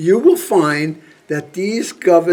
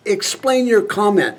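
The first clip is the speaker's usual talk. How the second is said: higher-pitched, incredulous challenge; exasperated demand; 6 semitones higher than usual. Acted. General challenging a senator over bombing Russia, exasperated demand. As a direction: demand an answer in a raised pitch.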